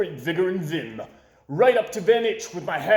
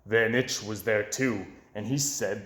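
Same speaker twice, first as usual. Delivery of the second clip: ordinary delivery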